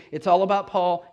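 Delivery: normal talking voice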